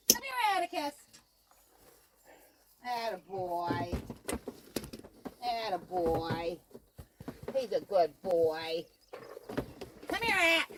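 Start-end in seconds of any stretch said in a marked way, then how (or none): 2.8-8.8 soft low praise. Real, not acted. Man praises the goat in a low, soft voice. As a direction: murmur praise to calm the goat.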